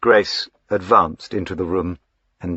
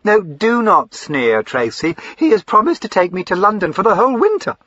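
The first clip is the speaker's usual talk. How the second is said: higher, excitable fine lady; eager young lady Lavinia; 13 semitones higher, 7 dB louder than usual. Acted. Lavinia gushing to her brother, high and eager. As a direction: gush up high like a spoilt sister.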